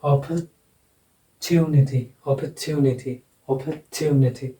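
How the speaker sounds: "Opportunity" as op-uh-TYOO-ni-ty, stressed on the third syllable; stress demonstration. Shown as a demonstration. Teacher showing stress before the suffix, "opportunity" said op-uh-TYOO-ni-ty. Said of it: "इससे पहले का जो भी syllable".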